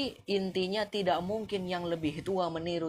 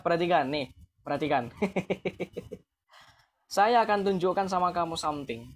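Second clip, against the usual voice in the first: ordinary delivery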